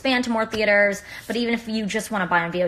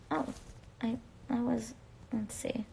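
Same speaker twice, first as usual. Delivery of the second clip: whispering to self; 15 dB quieter than usual. Real, not acted. Fumbling with the live invite.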